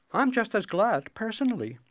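high, bright princess voice; cartoon princess. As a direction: play the pert young princess.

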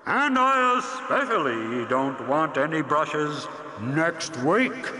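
slow, loud booming roar; roaring dragon. Acted. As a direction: boom every word like a dragon. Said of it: "the voice roared".

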